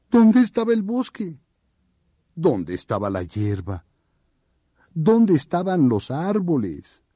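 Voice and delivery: preguntas agudas y perplejas; desconcierto. Acted. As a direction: como alguien totalmente perdido. Oso perdido y desconcertado.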